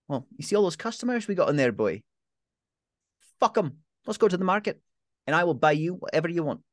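aunt ditching the pub to spoil her nephew; defiant cheerful aunt. Acted. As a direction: blow off the customers with relish.

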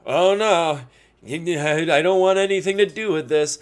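lightly voiced friend backing out; mock reluctance. Buddy imitating his friend walking away, mock reluctance. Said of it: "you were just like".

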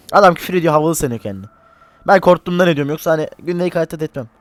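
normal talking voice

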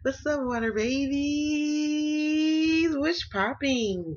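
high drawn-out singsong hello; singsong greeting. Real, not acted. Tarot reader greeting her viewers in a high singsong. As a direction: stretch the greeting out high and slow.

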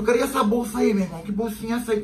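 high, prying cop voice; suspicious cop. Retold or imitated. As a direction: poke at him about the little bag. Cop questions friend about bag.

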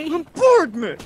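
squeaky high mimicry; mocking echo. Friend mimicking another's complaint about being bombarded, high and mocking. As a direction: parrot it back way up high.

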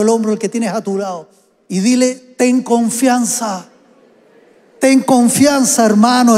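fuerte y lento, exhortando a la iglesia; proclámalo alto, alargando cada "ten confianza"; predicación a toda fuerza. Acted. (predicador que exhorta a la congregación, con fuerza)

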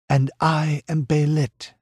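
high, proud cry of her own name; pirate queen crying her name to Conan. Acted. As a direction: proclaim your name to him. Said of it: "she cried".

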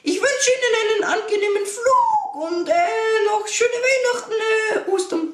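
squeaky high cartoon mouse voice, flustered; very high cartoon mouse pilot, stumbling; Mickey Mouse voice. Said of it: "fuhr der Pilot fort".

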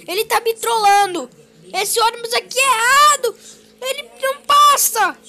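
high, loud, upset complaint; girl shouting that she took the wrong bus. Acted. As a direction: yell it out in dismay.

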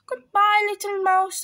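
high, loud panicked goodbye; hasty frightened farewell. Acted. Fox takes leave of mouse in fright, high and loud.

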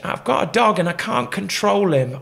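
high, loud woman's warning, imitated; woman's calling voice. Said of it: "I'm like, she".

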